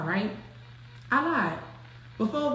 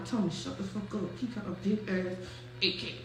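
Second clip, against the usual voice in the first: much softer, lower warning; urgent hush; 4 semitones lower, 11 dB quieter than usual. Retold or imitated. Friend hushing the narrator about the gun, soft and low. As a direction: hush him fast, there's a gun. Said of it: "I hear Noonie argue".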